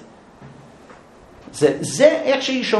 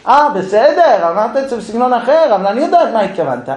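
sarcastic wife; 5 semitones higher, 4 dB louder than usual. Acted.

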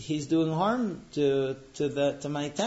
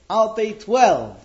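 loud, slow, emphatic final offer; a proud bidder going all in; triumphant top bid; 6 semitones higher, 8 dB louder, slower than usual. Acted. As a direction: slam down the winning bid, drawn out. Tailor outbids Roman at auction.

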